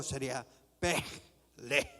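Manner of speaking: ordinary delivery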